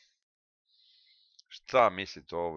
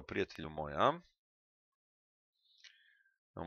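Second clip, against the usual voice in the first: normal talking voice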